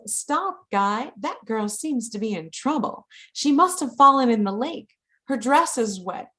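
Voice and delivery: a hint of 1920s Transatlantic accent; lightly voiced 1920s lady. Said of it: "using my nineteen twenties accent".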